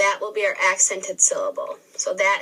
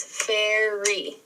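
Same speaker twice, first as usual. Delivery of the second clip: "fairy" slowly drawn out as fai, ry; split into syllables. Shown as a demonstration. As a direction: sound out "fairy" in two beats. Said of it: "break down those syllables".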